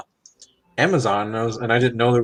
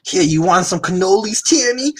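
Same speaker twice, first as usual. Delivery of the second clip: put-on New York Italian accent; 8 semitones higher than usual. Offering cannolis to a friend in a hammed-up New York Italian accent. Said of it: "I picked cannolis in that accent".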